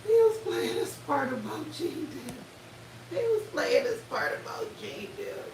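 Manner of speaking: high-pitched, cracking up while talking; laughing. Real, not acted. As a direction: squeal it out, helpless with laughter. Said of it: "I'm laughing, honey".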